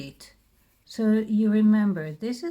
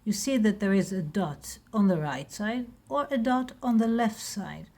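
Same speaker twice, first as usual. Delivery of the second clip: normal talking voice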